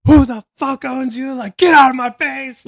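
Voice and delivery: furious high yell, acted out; very loud, high retold outburst; re-enacted screaming. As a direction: scream him away from you. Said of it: "I'm just screaming at this guy".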